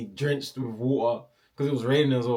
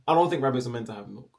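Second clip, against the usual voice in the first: ordinary talk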